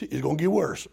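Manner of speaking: ordinary talk, nothing marked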